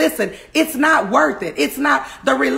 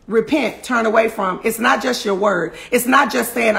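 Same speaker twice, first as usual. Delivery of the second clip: ordinary delivery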